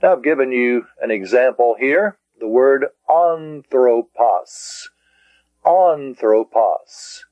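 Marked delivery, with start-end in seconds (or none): 3.1-4.9 slow an-thro-pos, each part separate; syllable by syllable. Shown as a demonstration. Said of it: "I divided that into syllables". 5.6-7.2 Anthropos very slowly as An-thro-pos; three measured beats on Anthropos; syllable by syllable. Shown as a demonstration. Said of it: "I divided that into syllables".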